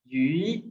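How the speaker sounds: यू drawn out with a rise; second tone. Shown as a demonstration. Teacher reading the word for fish, rising tone on यू. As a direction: lift यू slowly like a question. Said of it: "second".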